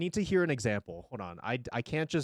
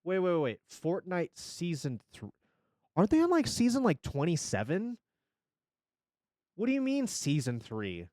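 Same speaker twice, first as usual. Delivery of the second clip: high, incredulous; stunned disbelief; surprised; 4 semitones higher than usual. Real, not acted. Surprised at season number.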